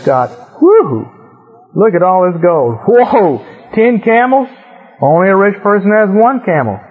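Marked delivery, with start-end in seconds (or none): 0.6-1.1 greedy whoo. Retold or imitated. Laban gawks at the gold. 2.9-4.5 greedy amazement. Retold or imitated. Laban counts the camels.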